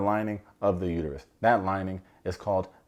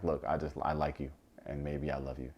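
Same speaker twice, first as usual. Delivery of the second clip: soft, lower, tender mock confession; hushed confession; 7 semitones lower, 11 dB quieter than usual. [name] confesses his love to Catherine, much softer and lower. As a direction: murmur it like a shy guy confessing love.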